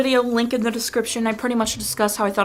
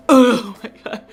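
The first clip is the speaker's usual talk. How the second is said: loud mock-overwhelmed outburst; overwhelmed reaction; 2 semitones higher, 7 dB louder than usual. Reacting to Lady meeting the baby, mock overwhelmed. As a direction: burst out as if overcome, retelling it.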